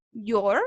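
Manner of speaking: your said like the English possessive your; pronounce your. Shown as a demonstration. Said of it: "va a sonar muy igual".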